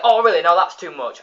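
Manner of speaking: loud, high indignant snap; mock outrage at being slapped; offended retort. Bob turning on Homer, loud and indignant. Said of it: "he's like".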